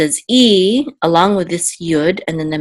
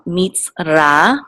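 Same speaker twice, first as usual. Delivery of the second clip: meets ra said slowly in two parts; slow, separated meets ra; syllable by syllable. Shown as a demonstration. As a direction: sound out meets, then ra. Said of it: "this is going to say ra".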